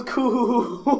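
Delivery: a little cooing sound; cute coo. Retold or imitated. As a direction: coo like the character. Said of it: "she goes".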